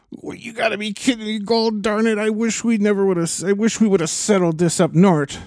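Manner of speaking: a Minnesota Witch King voice; folksy Minnesota grumbling, lightly put on; Minnesota accent. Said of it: "speaking of Minnesota Witch King".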